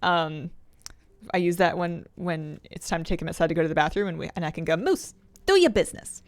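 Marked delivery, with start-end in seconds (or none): 4.8-6.2 high-pitched bad East Coast accent; terrible Boston-ish accent, pitched way up; fake Boston accent. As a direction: call out in a hammy Boston accent. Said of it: "fake Boston slash".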